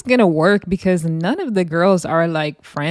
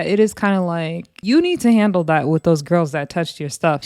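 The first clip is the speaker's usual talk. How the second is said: usual speaking voice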